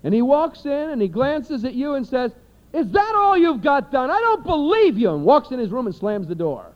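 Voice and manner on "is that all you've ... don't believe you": high, loud, indignant boss snapping at a worker; incredulous boss voice; boss scolding. Retold or imitated. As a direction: bark it like a fed-up boss.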